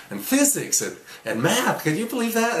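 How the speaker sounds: acted excited fatherly boast; amazed pride. Father marvels at son's learning.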